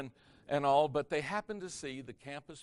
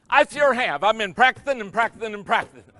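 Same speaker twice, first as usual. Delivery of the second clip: much higher and louder triumphant shout; proud exclamation; 8 semitones higher, 14 dB louder than usual. Retold or imitated. Joe proudly telling the girl he has been practising. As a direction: burst out proudly, all joy.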